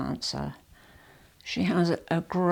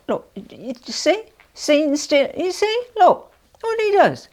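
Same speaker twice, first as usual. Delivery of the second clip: loud, rising, much higher squeals; excited exasperation; 11 semitones higher, 10 dB louder than usual. Real, not acted. Wife complaining loudly to viewers about her husband, shrill high squeals. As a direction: shriek at him in laughing disbelief. Mocking husband fidgeting on camera.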